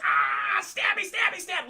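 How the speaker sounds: high, loud frenzied mock scream; hammed-up stabbing frenzy; Ben Stiller screaming. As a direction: yell it like a man losing his mind. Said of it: "Ben Stiller goes".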